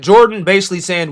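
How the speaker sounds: ordinary talk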